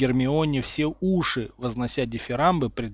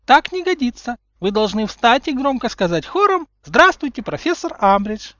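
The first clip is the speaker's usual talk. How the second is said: put-on much higher and louder, sugary little-girl voice; girlish high voice; 11 semitones higher, 8 dB louder than usual. A narrator's reading. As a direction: chirp it like a sweet girl. Umbridge instructing class. (высоким девичьим голоском)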